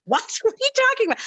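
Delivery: high, startled disbelief; imitating a stunned father, pitch shooting up; mock shock from a dad; dad's incredulous what. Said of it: "my father looks and goes".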